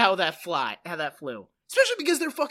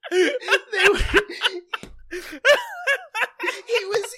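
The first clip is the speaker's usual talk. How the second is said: laughing through the words, higher; giggling while getting it out; 10 semitones higher, 4 dB louder, much slower than usual. Real, not acted.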